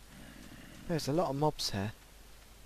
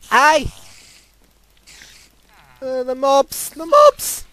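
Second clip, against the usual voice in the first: shrieking, much higher and loud; panicked scream; 10 semitones higher, 12 dB louder, slower than usual. Real, not acted. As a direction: scream in terror as they come. Mobs swarm at night.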